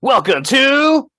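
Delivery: big, high, drawn-out segment intro shout; announcer shout. Pretend. Host booms out the podcast segment intro, announcer shout. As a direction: holler the intro loud, stretching it out.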